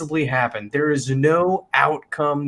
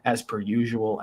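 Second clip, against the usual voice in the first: usual speaking voice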